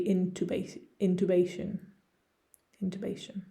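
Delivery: American "intubation", in-TOO-bay-shun; American pronunciation. Shown as a demonstration. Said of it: "If I was from the United States".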